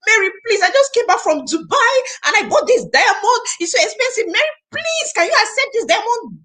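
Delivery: high pleading voice, mock begging; hammed-up plea to take a gift; begging friend. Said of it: "let me take for example".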